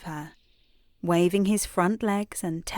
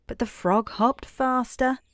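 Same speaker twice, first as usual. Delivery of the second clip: ordinary delivery, nothing marked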